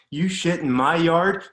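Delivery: furious shout. Retold or imitated. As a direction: shake him as you shout it. Dad grabbing kid, raging.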